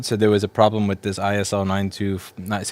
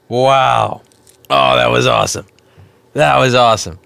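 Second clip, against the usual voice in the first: loud burning exclaim; excited; 3 semitones higher, 9 dB louder than usual. Real, not acted. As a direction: shout through the sting. Exclaiming after wasabi.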